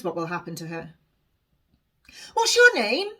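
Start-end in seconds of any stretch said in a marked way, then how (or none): 2.3-3.1 high, loud call from behind; shouting. A narrator's reading. Honesty shouts after Alice in the street. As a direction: shout after someone walking away. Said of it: "she shouts at my back".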